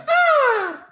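high falsetto moans, hammed-up; mock moaning. Comedian imitating the porn actress, mock falsetto moans. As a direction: mimic her moaning in a squeaky falsetto. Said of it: "forty seconds of".

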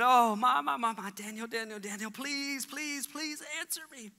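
the king begs Daniel to answer; anxious king pleading. Acted. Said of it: "Darius said".

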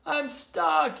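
high-pitched storybook yell; high cry from someone stranded. A narrator's reading. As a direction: yell it out in helpless alarm. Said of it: "yelled Tudley".

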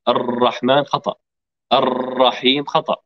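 ordinary talk

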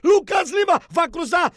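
usual speaking voice

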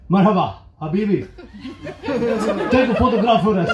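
put-on laughing half-baked Arabic accent, high; giggling through a fake Arabic accent. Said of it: "in my half-baked Arabic accent".

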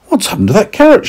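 high, puzzled lady voice; lady searching her kitchen, puzzled. Acted. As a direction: wonder aloud where it went. Lady looks for the carrot.

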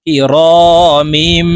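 "র" drawn out, "মিম" hummed long; iqlab ghunnah. Shown as a demonstration. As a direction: stretch "র", then hold the m of "মিম" nasally. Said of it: "এই মিম ছোট্ট মিমের সাথে গুন্নার সহিত পড়ব". ("মিম"-এ নাকে টেনে গুন্নাহ)

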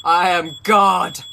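hammed-up god-king; mock-grand proclamation. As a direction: declare yourself ruler in jest.

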